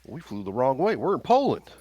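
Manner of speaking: rising pitched alarm; excited. Acted. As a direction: blurt it out, high. Player voices Slim.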